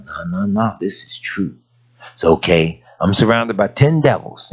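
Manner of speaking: normal talking voice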